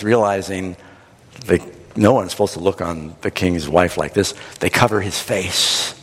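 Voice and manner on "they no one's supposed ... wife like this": ordinary delivery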